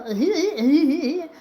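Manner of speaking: short high laugh; laughing at own slip. Real, not acted.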